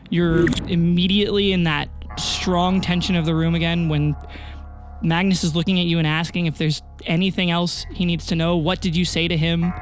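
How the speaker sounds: ordinary delivery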